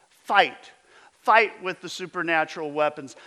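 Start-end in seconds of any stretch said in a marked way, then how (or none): 0.1-0.7 loud, punched single word; preached command. Acted. Preacher urging the congregation to fight, loud. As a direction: hurl the word at the crowd.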